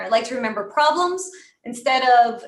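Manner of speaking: problems leaned on with a falling tune; stress on problems. Shown as a demonstration. Coach showing the pitch line, stressing problems. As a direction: lift and land the word problems. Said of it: "really emphasize some key words in there".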